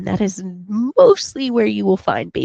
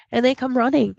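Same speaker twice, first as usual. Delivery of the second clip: ordinary talk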